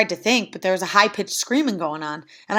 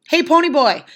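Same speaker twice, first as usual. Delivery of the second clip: higher, louder urgent hail; sudden raised-voice call; 7 semitones higher, 5 dB louder than usual. A narrator's reading. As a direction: call to a friend to get his attention. Johnny calling out in the fire.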